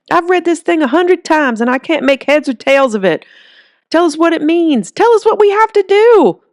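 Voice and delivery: rising to a frantic plea; desperate old man. Acted. Old man pleads for meaning.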